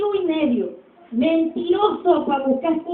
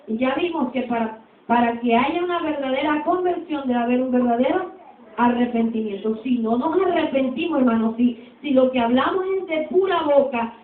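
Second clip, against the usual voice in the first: ordinary talk, nothing marked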